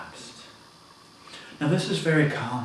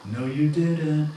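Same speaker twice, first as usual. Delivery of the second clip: higher, louder, slow; slow raised insistence; 5 semitones higher, 6 dB louder, slower than usual. Retold or imitated. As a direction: drag it out loud.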